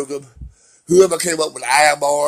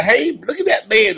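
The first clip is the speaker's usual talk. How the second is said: higher, bright mock child calling out; imitating little kids; 8 semitones higher, 5 dB louder than usual. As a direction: play a kid pointing at a swimmer. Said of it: "little kids".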